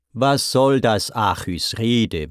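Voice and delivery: ordinary delivery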